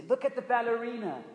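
high, loud sneering jeer at the ballerina; sing-song mockery to humiliate her; mocking taunt. Bullies jeering at a classmate, in a high loud mocking voice.

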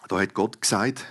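ordinary delivery

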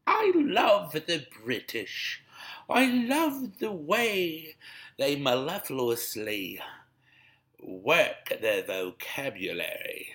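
high, slow, put-on English voice; a hammed-up queen-like British drawl; mock posh British. Mocking how the British talk, in a slow high put-on English accent.

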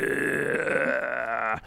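softly voiced creature noise; troll noise. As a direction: imitate a troll's drawn-out growl. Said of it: "the troll just, like, glares over him".

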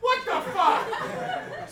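very high, drawn-out incredulous cry; high-pitched outraged astonishment; loud disbelief. Real, not acted. Host reacts to couple's vague answer.

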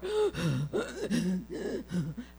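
a mock gasp for breath, lightly done; imitated gasp. Nephew winded after the groceries, mock gasp.

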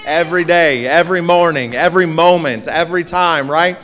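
loud, high-pitched, rhythmic testimony; fervent preaching. Real, not acted. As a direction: drive each repeated "every" home to the congregation. Preaches at a rising pitch of fervour.

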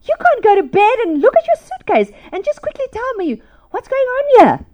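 high-pitched telling-off of her son; scolding mom. Retold or imitated. Mom waking Josh over his messy suitcase, scolding.

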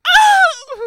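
very high loud "Ah!"; scream of pain. Retold or imitated. Catcher hit by the bat, screaming in pain. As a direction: let out a sharp scream. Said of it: "I was just like".

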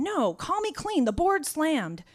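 high, slow, loud dispatcher voice; brushing-off dispatcher. Retold or imitated. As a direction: wave it off like a dispatcher.

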